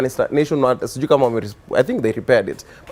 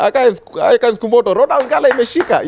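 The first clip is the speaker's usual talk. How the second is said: high, loud mock radio warning; excited walkie-talkie report, acted out; imitated walkie-talkie alert; 12 semitones higher, 5 dB louder than usual. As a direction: call out trouble to fellow protesters. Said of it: "it's like a walkie-talkie thing".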